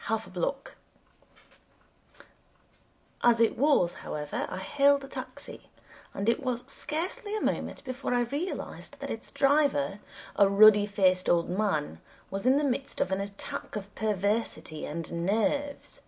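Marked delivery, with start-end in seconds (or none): none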